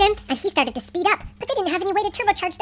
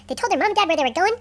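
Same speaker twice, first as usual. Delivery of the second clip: usual speaking voice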